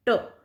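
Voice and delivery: ट as an isolated "t" sound with a short schwa; ट said as a bare phoneme; T sound demo. Shown as a demonstration. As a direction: sound out ट alone, "tuh". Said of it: "यानी हमने ट sound use किया है".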